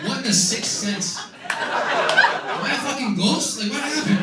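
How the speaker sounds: high, slow, loud panicked yelling; frantic friend. Retold or imitated. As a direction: play the ignored friend losing it. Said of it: "Preston snapping, waving".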